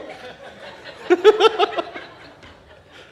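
high unvoiced laugh; real laugh; breathy laugh. Real, not acted. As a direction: laugh at your own joke. Laughing at own joke.

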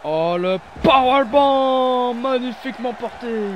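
loud, slow, high excited call; excited commentator call. Real, not acted. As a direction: savor the powerbomb loudly. Big powerbomb called.

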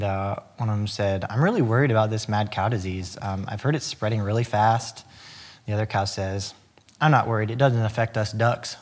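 ordinary talk

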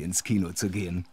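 ordinary delivery

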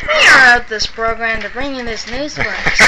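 put-on old radio announcer. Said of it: "say what you were saying a minute ago".

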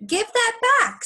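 high, loud "give that back"; a kid snapping for his apple; demanding kid. Acted.

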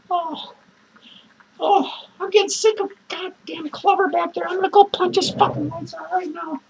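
ordinary delivery

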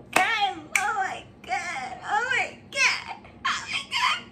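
teary laughing disbelief, slowed down; overwhelmed squeal. Real, not acted. Vlogger overcome by an unboxing surprise, teary squeal.

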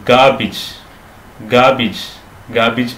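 ഗാബിജ് as "GAA-bij", silent r; no r, short "-ij" in ഗാബിജ്; correct pronunciation. Shown as a demonstration. Teacher modelling for learners, ഗാബിജ് as "GAA-bij". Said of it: "ആറ് silent ആണ്".

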